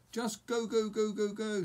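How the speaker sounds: high, loud frantic scream of go. Acted. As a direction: scream it frantically, flapping the arm. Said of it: "screamed Hiccup".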